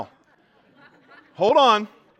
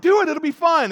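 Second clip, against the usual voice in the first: mock eager urging; 11 semitones higher, 7 dB louder than usual. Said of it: "I'm like".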